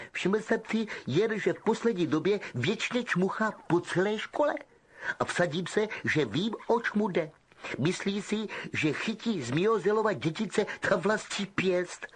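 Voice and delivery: Malfoy mocking Percy; Malfoy sneering. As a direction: spoilt snob gossiping. Malfoy voiced.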